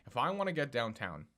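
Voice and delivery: usual speaking voice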